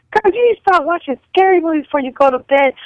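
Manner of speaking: high-pitched imitation of mom; scolding mom. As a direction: tell her off like an exasperated mother. Mother scolds daughter, retold.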